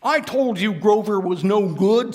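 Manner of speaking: put-on high, loud gloating kid; Truman voice. Truman mocking Grover after the game, gloating kid voice. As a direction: rub it in. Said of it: "letting me do the voices".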